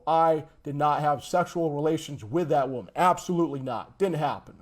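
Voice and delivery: usual speaking voice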